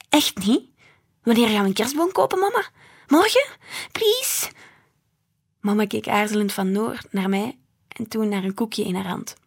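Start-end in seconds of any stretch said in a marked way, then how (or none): 0.1-4.5 raised, excited begging, climbing very high at the end; eager child pleading. Acted. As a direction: beg mama like an impatient child. Excited child begs for tree. (opgewonden smekend kind)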